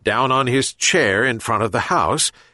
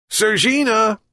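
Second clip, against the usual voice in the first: calling out; 12 semitones higher than usual. A narrator's reading. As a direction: call the cat by name, pitched high. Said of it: "called the old man".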